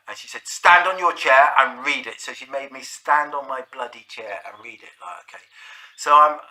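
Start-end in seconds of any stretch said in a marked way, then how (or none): 0.5-2.2 mock stern order. Teacher orders him up.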